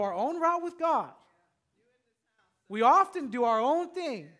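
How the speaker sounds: loud, high preaching; fervent pulpit preaching. Acted. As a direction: drive it home from the pulpit. Preaching at full force.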